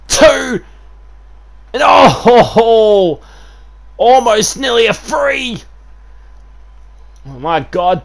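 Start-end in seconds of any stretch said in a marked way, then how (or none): 0.1-5.6 high, loud near-fall yell; excited shout. Real, not acted. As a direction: gasp as he kicks out.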